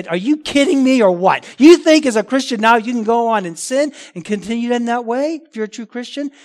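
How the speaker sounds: loud, forceful rhetorical questions; incredulous challenge. Acted. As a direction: challenge them as if the idea were absurd. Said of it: "I would say it".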